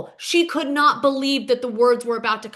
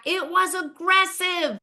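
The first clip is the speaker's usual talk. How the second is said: imitated scream back; 6 semitones higher, slower than usual. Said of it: "Emily screams back".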